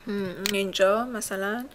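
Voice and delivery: normal talking voice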